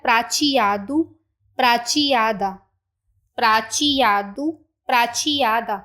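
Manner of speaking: pra-chi-A-do, careful and clear; model pronunciation. Shown as a demonstration. Teacher models prateado and prateada for learners. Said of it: "पहले हम इनकी pronunciation को देख लेते हैं".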